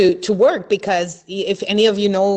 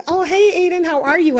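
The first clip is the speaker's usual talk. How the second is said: high, louder cheerful hello; friendly greeting; 10 semitones higher, 4 dB louder than usual. Retold or imitated. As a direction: call out warmly to the kid in a lifted voice. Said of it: "and be like".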